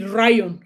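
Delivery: "Ryan" for lion, with R replacing L. Shown as a demonstration. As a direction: swap the L for an R in "Ryan". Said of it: "L is R, and R is L".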